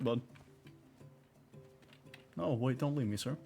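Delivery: ordinary talk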